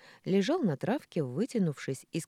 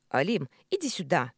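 louder, commanding call; barked summons; 2 semitones higher, 6 dB louder than usual. A narrator's reading. Николя summoning his worker, barking. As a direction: call him over sharply. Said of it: "гаркнул Николя".

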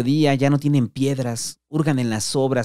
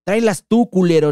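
much higher and louder, a shouted snap back; angry retort; 8 semitones higher, 7 dB louder than usual. Acted. Juan shouting back at the patas, angry. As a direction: fling it back as the door slams. Retort while slamming door.

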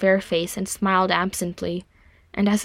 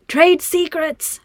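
high, drawn-out holler; loud sing-out from afar; 9 semitones higher, 5 dB louder, much slower than usual. A narrator's reading. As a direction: call out slowly to someone out of sight. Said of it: "Nori hollered out of sight".

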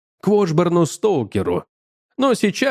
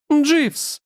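squeal; 12 semitones higher than usual. A narrator's reading. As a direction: squeal the name like Полина, pitched way up. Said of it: "взвизгнула Полина". (взвизгнуть высоким голосом)

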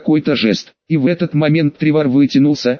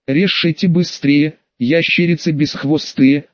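usual speaking voice

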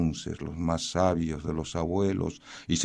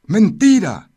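very high loud retort; talking rock; 13 dB louder than usual. Acted. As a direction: shriek the denial. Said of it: "dijo la piedra".

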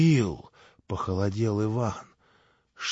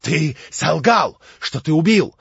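loud, higher, raging accusation; furious yell; 6 semitones higher, 10 dB louder than usual. A narrator's reading. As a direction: hurl it at him. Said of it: "бешено завопил Иван".

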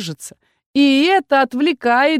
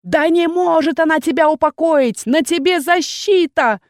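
yelled taunt; 7 semitones higher than usual. Acted. Captain yells at the goblin across the field, mocking. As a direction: yell that she can't do it. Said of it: "завопил капитан некромантов Эстер Уон".

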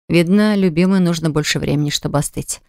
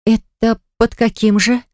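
sharp interruption; 5 semitones higher, slower than usual. A narrator's reading. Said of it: "резко перебила она его".

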